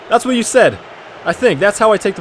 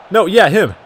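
ordinary talk

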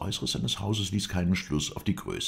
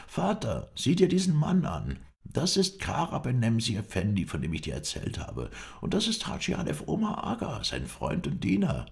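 put-on high, ringing, excited young man; delighted, pitch leaping high; overjoyed greeting; 6 semitones higher than usual. A narrator's reading. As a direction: burst out with joy, then introduce proudly.

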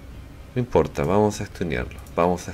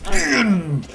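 excited yelp; 4 dB louder than usual. Real, not acted. Excited win shout.

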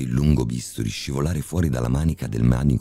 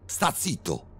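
sharp, much higher barked order; commanding; 10 semitones higher than usual. A narrator's reading. Father silencing his son, harsh commanding bark. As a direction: cut the boy off hard. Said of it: "comandò".

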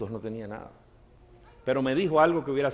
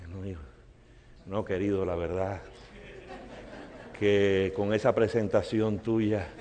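hesitant low-pitched non-recognition; puzzled inner monologue; 6 semitones lower, 6 dB quieter than usual. Retold or imitated. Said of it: "Pero yo no le decía nada".

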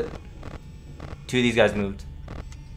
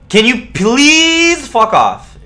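drawn-out scream; 12 semitones higher, 9 dB louder, much slower than usual. Real, not acted. Yelling at the duck.

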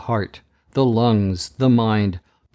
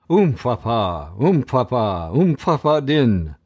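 chanted drum rhythm in an altered voice; drumbeat chant. A narrator's reading. Drums beating agreement, voiced as a steady chant. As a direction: beat it out like war drums. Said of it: "as the drums beat".

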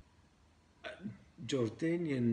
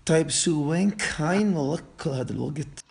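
angry yell; 3 semitones higher, 13 dB louder than usual. Acted. Boss demands where he has been.

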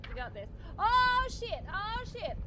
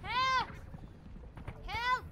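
shouting for help; 6 semitones higher than usual. Real, not acted. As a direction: call out in real panic. Caught by monster, calling friend.

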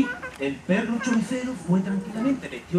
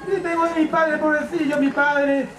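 pitiful plea; 8 semitones higher, 3 dB louder, slower than usual. Acted. Dog laments his father.

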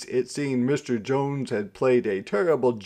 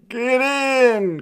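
high, loud, exuberant holler; exuberant cry; 14 semitones higher, 9 dB louder than usual. A narrator's reading. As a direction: cry it out with a burst of energy. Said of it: "cried the driver exuberantly".

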